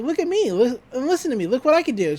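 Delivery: high-pitched imitated attention-seeker; Mabel. Girl distracting the cowboy, high mock attention-seeking voice. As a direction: pitch it up, pestering to be watched. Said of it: "and Mabel's like".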